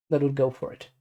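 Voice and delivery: normal talking voice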